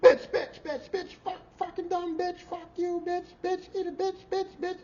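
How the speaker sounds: high, rapid-fire cursing tirade; furious ranting. Retold or imitated. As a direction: spit the curses out high and nonstop. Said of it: "he's just bitching her to death".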